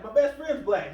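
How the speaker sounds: imitating a frustrated officer defending himself; retold frustration. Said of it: "he gets kind of frustrated with this".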